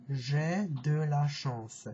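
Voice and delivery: "J'ai de la chance" drawn out slowly, syllable by syllable; slow French. Shown as a demonstration. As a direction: stretch "J'ai de la chance" for the learner.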